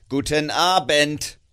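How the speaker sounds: loud, slow, firm dismissal; impatient goodbye. Acted. Scrooge repeats his goodbye to the nephew, slow and firm. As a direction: press it out impatiently.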